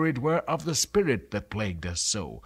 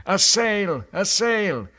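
higher, louder desperate cry; 8 semitones higher, 7 dB louder than usual. Acted. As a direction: shout it out, spotting a ship. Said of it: "and cried".

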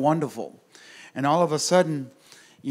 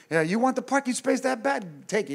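gentle driver; 9 semitones higher than usual. Retold or imitated. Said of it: "I'm like that".

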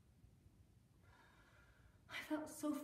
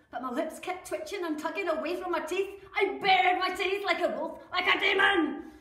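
snarling fury; 3 semitones higher, 12 dB louder than usual. Acted. Wife describing her snarl, loud and furious. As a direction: spit it out like a cornered animal.

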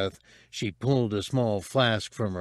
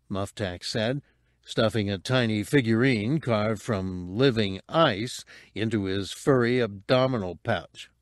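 plain reading voice

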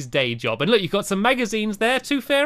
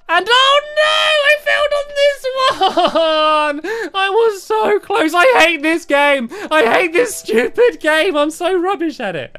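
mock frustrated wail; 12 semitones higher, 7 dB louder than usual. Real, not acted. Failing the jump in game.

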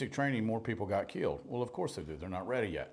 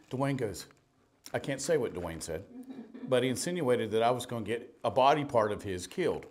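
ordinary delivery

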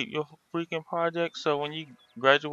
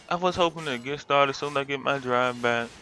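ordinary talk

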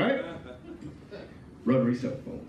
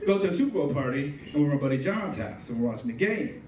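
ordinary talk, nothing marked

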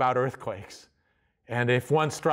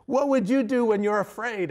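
mock questioner; 6 semitones higher than usual. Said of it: "So you could ask him".

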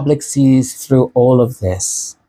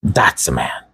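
whispered in admiration, low and soft; 8 semitones lower, 4 dB quieter than usual. Shown as a demonstration. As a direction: breathe it out with quiet awe. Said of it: "repeated, then whispered".

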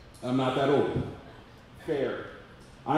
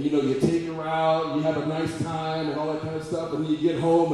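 ordinary talk

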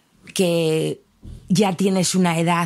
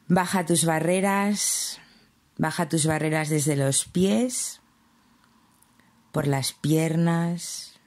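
guided meditation; 2 semitones lower than usual. Acted. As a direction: ease the listener down gently, phrase by phrase.

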